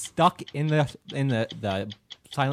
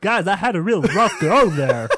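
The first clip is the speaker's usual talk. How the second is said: loud, high comedian impression breaking into laughs; Bobcat Goldthwait impression; 6 semitones higher, 8 dB louder than usual. Co-host playing the disguised sound engineer, loud high comic impression. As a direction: ham up a struggling comic's confession. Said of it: "Andrew is Bobcat Goldthwait in disguise".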